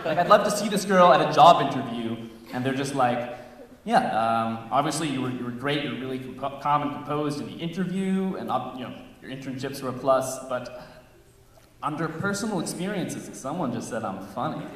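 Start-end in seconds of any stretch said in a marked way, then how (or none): 3.8-13.3 job interviewer. Acted. Comedian acts out job interviewer.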